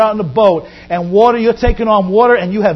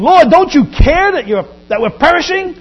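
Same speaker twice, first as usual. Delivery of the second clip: frightened cry; 7 semitones higher, 5 dB louder than usual. Acted. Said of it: "crying and shaking, and they're saying".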